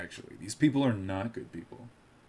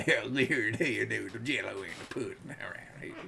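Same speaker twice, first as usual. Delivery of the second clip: light trailing Bill Cosby voice; Bill Cosby impression; 5 dB quieter than usual. Joking to chat, winding down the Bill Cosby voice. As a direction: let the Bill Cosby voice fade out. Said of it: "a Bill Cosby voice".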